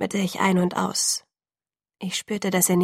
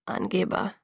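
softer, lower grumble of „Angeber“; grumbled; 5 semitones lower, 5 dB quieter than usual. A narrator's reading. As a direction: mutter „Angeber“ under your breath. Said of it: "brummelte ich". (leise gebrummelt)

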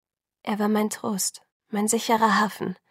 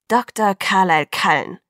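louder, scornful naming; contemptuous; 5 dB louder than usual. A narrator's reading. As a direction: spit the name out with scorn. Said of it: "stieß er verächtlich hervor".

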